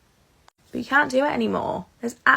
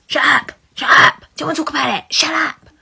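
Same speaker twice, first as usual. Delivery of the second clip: put-on shrieked child tantrum, much higher and louder; imitating a screaming daughter; 7 semitones higher, 11 dB louder than usual. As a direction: scream it like a furious kid. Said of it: "And she just goes".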